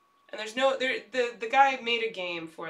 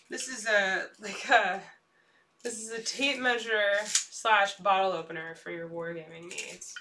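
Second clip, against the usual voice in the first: usual speaking voice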